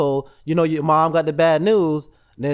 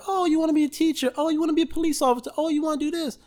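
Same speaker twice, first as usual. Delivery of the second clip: much higher, sing-song teacher voice; mock approving teacher; 10 semitones higher, faster than usual. Teacher praising students' career papers, high sing-song approval. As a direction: play the pleased teacher, bright and fast.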